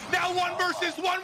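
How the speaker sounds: high and loud announcement; excited shout. Real, not acted. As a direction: call it out at full hype. Caster hype in clutch.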